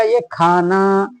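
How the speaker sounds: ये खाना drawn out very slowly; spelling out खाना, very slow; slow demo. Shown as a demonstration. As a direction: stretch out ये खाना for the class. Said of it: "क्या बन जाएगा?".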